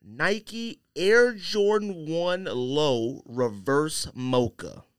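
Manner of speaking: normal talking voice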